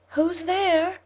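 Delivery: high frail call from the bed; old grandmother. Acted. Grandmother asking who knocks, high and calling. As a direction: grandmother calling through the door.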